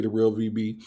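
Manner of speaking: ordinary talk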